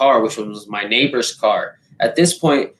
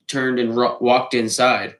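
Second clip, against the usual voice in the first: ordinary talk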